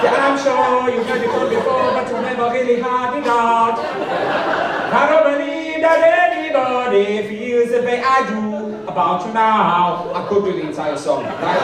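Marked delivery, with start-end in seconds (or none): 0.0-10.1 put-on loud, high sung German accent. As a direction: croon it like a German pub singer. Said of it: "in a German accent".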